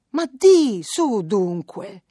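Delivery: ma di shot out high and loud, then su dunque slow; anxious mother pressing for the truth; urgent prompt. Acted. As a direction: push her to speak, suddenly loud then slowed. Anxious mother urging daughter.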